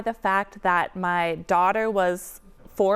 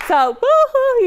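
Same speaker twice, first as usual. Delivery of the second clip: excited cheer; 9 dB louder, slower than usual. Real, not acted. Cheering at the happy news.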